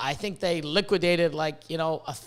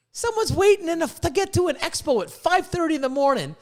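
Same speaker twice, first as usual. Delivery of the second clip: high, heated, incredulous; worked-up rant; 10 semitones higher than usual. Real, not acted. Telling breeders the industry isn't dead, high and heated. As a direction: pitch it up and mock the doomsayers. Ranting at doom talk.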